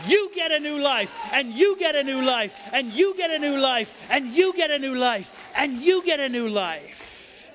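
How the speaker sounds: loud, high, jubilant proclamation; giveaway shout. Acted. Preacher announcing new life to congregation, ecstatic shout. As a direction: shout it like a prize host.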